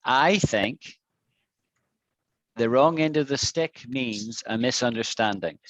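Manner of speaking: "misunderstanding" dropping down at the end; falling statement tune. Shown as a demonstration. Teacher modelling a statement for a student, falling tune. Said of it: "you should say this ... it went down".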